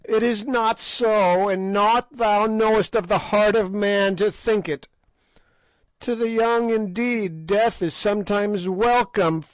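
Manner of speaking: a hag screeching contempt for youth; old witch raving at the king about age; ranting old fiend. A narrator's reading. Gagool rants about the old.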